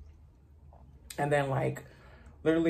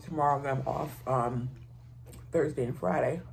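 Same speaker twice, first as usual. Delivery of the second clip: fork held at lips; speaking before a bite; talking while eating. Real, not acted. Seen 1: Holds loaded fork at lips while talking, then takes the bite.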